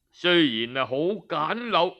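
usual speaking voice

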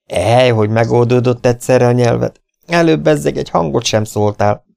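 gleeful mockery; mocking. A narrator's reading. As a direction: tease him about it, voice lifted. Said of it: "csúfolódott Joe".

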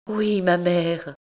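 drawn-out distraught yes; distraught, slow. Acted. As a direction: linger on oui, lost. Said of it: "la jeune fille éperdue". (oui très lent et éperdu)

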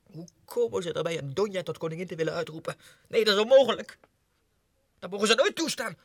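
fast, high, loud outrage; indignant rant. Acted. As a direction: rail against the scandal. Indignant rant about Spain. (verontwaardigd tieren)

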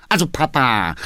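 impatient son cutting in on his father; a brisk, exasperated appeal. A narrator's reading. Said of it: "sagte der junge Mann ungeduldig".

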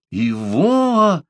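high, drawn-out cry of a name; astonished exclamation. A narrator's reading. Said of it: "воскликнул Парсел". (изумлённое восклицание)

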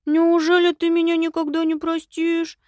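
high-pitched, theatrical hurt plea; feigned upset. A narrator's reading. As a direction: play wounded, high and overdone. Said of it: "наигранно расстроился Виталик". (наигранно расстроенно, высоким голосом)

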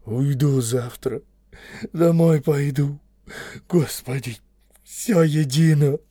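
slow, strained gloomy lament. A narrator's reading. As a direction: drag the words out in sullen despair. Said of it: "угрюмо заговорил".

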